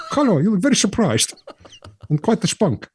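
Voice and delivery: put-on high, lilting Danish-Dutch accent. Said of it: "that's my Danish Dutch accent".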